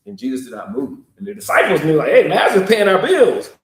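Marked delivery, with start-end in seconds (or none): 2.1-3.5 high and loud, urgent; loud warning. Acted. Disciples urging Jesus about Lazarus, loud and urgent. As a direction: press the point hard.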